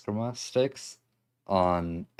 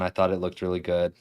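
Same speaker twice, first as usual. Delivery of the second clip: ordinary talk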